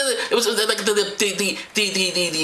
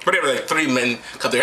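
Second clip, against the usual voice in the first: ordinary talk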